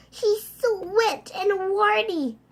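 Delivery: high, slow, loud whine; whiny disgust. Acted. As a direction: whine it, grossed out.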